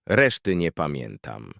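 ordinary delivery

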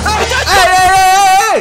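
slow, high, loud hey to stop someone; drawn-out calling out. Real, not acted. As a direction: stretch each hey to halt them.

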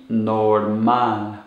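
"normal" in Spanish, drawn out with the weight on "mal"; stress on last syllable. Shown as a demonstration. Teacher modelling for learners, "normal" stressed on final "mal". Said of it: "put the stress or intonation on the last syllable".

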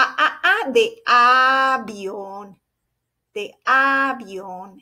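A repeated, then held long at the start of avión; letter sound demonstration. Shown as a demonstration. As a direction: sound out A, stretching avión slowly. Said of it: "Es la vocal A".

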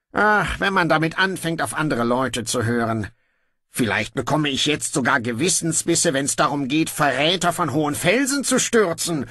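a lazy king giving in; offhand young king. Acted. Brocando grudgingly orders guards to release his brother, breezy.

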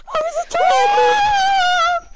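long, high, loud wail; anguished shout. Real, not acted. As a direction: wail in dismay at dying. Dying in game.

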